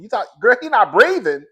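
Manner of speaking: hammed-up high, loud hollering; panicked hollering. Imagining herself as an overwrought dispatcher hollering along with the caller, mock panic. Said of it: "hollering and screaming and crying with y'all".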